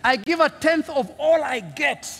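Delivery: slow, high, self-satisfied prayer; proud pray-er listing his virtues slowly; boastful Pharisee. Acted. Preacher voicing the proud Pharisee, slow and lofty.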